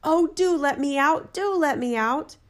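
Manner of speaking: slow, drawn-out pleading; plaintive. A narrator's reading. Bottled spirit begs the student for release, plaintive cry. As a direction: beg plaintively, stretching each plea. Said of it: "the spirit cried so plaintively".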